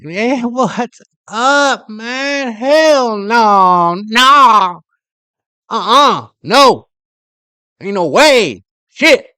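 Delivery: loud, high, drawn-out hollering. Retold or imitated. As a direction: holler it as an exaggerated street caricature. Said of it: "You got people talking like".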